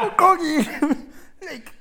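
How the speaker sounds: very high, loud word through laughter; laughing. Real, not acted. Storyteller cracks up at what he asked the host, laughing. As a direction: crack up on a slow, drawn-out word.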